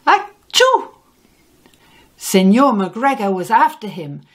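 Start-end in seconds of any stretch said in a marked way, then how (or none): 0.1-0.9 high squeaky little sneeze. A narrator's reading. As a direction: sneeze it out sharply. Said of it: "Pedro sneezed".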